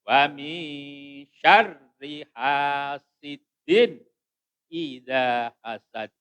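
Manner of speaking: slow chant, hidden n held in "wa mi syarri"; ikhfa recitation. Shown as a demonstration. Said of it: "ikhfa, ya".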